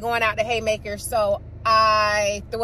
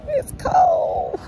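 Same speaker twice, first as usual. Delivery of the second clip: much higher shouted complaint; cold shout; 4 dB louder than usual. Real, not acted. Complaining to viewers about the freezing night, shouting. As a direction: yell it at the cold.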